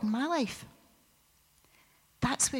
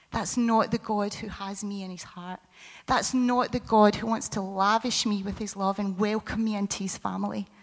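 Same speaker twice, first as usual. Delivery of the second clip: ordinary talk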